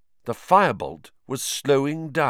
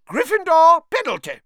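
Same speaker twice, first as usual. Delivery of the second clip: shrieking call; 7 dB louder than usual. Acted. Referee calls penalty.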